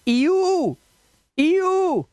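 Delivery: ईयू high, held long on the oo; drawn-out demonstration. Shown as a demonstration. Teacher models the EW sound, ईयू held long and high. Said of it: "तो ईडब्ल्यू को क्या पढ़ेंगे आप?".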